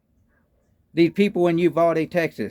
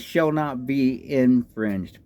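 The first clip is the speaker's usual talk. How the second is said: slow emphatic quote; 6 semitones lower, much slower than usual. Real, not acted. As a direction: read it slowly, word by word. Quotes the Second Amendment.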